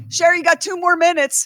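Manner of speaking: the producer hurries her along, called out; producer calling through the door. Retold or imitated. Producer banging on the door, calls out the time left. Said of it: "our producer banging on the door".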